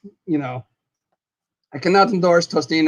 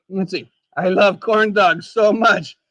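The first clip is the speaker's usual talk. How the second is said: normal talking voice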